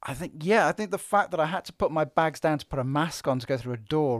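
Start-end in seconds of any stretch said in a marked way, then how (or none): none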